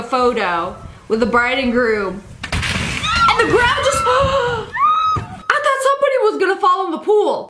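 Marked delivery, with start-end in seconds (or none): 2.5-7.5 shocked gasp. Real, not acted. The bridge collapses in the clip.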